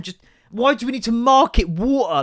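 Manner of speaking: ordinary delivery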